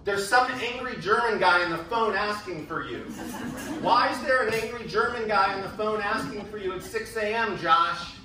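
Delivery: loud, high yelling down the hall. Retold or imitated. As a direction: shout it down the hallway. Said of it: "my roommate was yelling down the hall".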